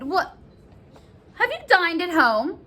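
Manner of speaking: sudden query. Acted. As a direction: break off to ask, taken aback. Nurse changes the subject.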